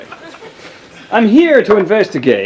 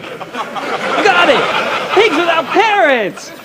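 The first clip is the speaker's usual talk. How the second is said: much higher, triumphant cry with a laugh; excited breakthrough; 12 semitones higher, much slower than usual. Acted. The detective, solving the charades, cries out excitedly. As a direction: shout it out like you've cracked the case. Charades guess finally solved.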